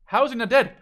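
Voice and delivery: can't believe he's still alive; disbelief. Real, not acted. Commentator stunned as a soldier survives, loud disbelief.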